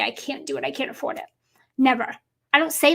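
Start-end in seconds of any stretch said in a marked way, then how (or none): 0.0-1.2 muttered under the breath, much softer; barely voicing the excuse; quiet excuse. Voicing an excuse she never makes, softly. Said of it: "I've never had to say".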